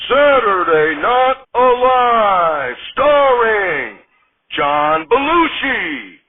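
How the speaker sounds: big, slow, high announcer read. Acted. Announcer introducing the cast and host, slow and booming. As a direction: stretch every name like a TV show opener.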